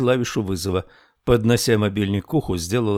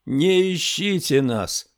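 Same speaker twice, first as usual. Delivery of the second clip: high, drawn-out call in narration; woman crying out; 7 semitones higher, slower than usual. A narrator's reading. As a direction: call out high and slow, without real volume. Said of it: "крикнула женщина".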